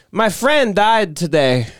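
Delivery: put-on slow, high, mournful old man; mock sad. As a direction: lay on the grief, drawn out slowly. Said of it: "And then the guy goes".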